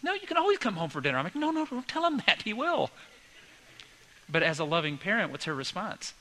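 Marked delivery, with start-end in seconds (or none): 0.0-1.2 high, quick imitation of a wife; mock motherly insistence, pitched way up; protesting mom. Wife's protesting reply to son. 1.3-2.9 high-pitched mock panic; comic retold objection, pitched way up; alarmed protest. Husband's alarmed retort to wife.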